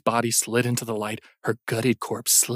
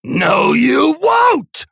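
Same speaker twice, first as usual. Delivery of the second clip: much higher and louder shout; furious roar; 14 semitones higher, 8 dB louder, much slower than usual. Acted. Brian roars defiance at the monster. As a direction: blast it back with all your anger. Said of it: "Brian roared".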